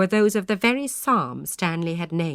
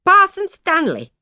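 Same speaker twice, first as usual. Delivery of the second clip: much higher, loud cry of his name; undaunted cry; 12 semitones higher, 9 dB louder than usual. A narrator's reading. Aunt crying out to the parson, not a whit cowed. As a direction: call him out boldly, unbowed. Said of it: "not a whit discomfited".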